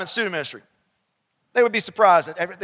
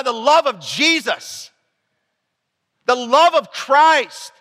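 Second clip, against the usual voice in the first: preaching at full force; 4 semitones higher than usual. Acted. Pastor urging his church to pursue strangers, preaching hard. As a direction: drive it home from the pulpit.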